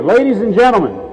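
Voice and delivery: normal talking voice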